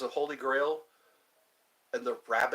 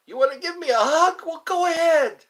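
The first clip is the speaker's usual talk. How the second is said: high and loud, eager; excited; 13 semitones higher, 8 dB louder than usual. Real, not acted. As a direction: throw it out like open arms. Inviting a hug excitedly.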